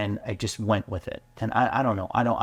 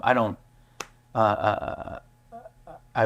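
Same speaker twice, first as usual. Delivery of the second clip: ordinary talk, nothing marked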